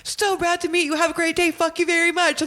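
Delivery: high, sunny pleasantries then "fuck you"; sing-song cheerful politeness; chatting up a camp visitor, lightly high and bright; friendly mock voice. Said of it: "having a full-blown conversation".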